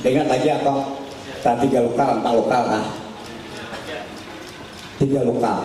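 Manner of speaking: ordinary talk, nothing marked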